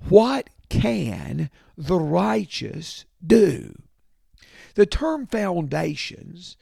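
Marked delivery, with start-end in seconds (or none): 0.1-3.7 louder and slowed, pressing the question home. Acted. Preacher pressing the congregation with the psalm's question, loud and slow. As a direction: boom out the question, stretching it slowly.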